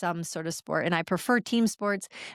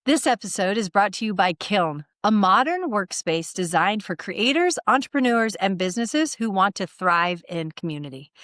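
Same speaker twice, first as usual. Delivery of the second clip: louder, lifted, polished promo read; sponsor ad read; 4 dB louder than usual. Acted. Podcast host promoting a workspace sponsor, announcer-like ad read. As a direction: sell it with punched key words.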